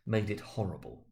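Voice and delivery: ordinary delivery, nothing marked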